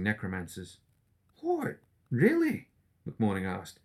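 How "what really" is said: high, startled disbelief; surprised. Acted. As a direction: squeak it out in shock. McMorning startled by being dismissed.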